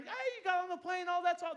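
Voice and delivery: high, excited cheer; cheering teammates. Retold or imitated. Team cheering him onto the plane, high and excited. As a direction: voice the team's cheer. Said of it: "my whole team is cheering".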